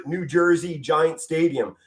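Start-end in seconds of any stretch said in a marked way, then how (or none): none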